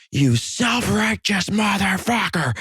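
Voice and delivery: high, drawn-out hammed-up yell; mock yelling. Angry drunk guy berating a sober stranger, mock yelling. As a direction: imitate an outraged yeller, stretched out and high. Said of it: "And he starts yelling".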